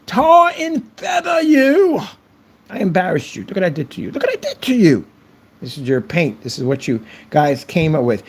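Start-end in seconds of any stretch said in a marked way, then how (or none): 0.0-5.0 high shouting taunt, drawn out; mock victory yell. Mocking the trolls, a high gloating yell. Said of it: "Just yelling".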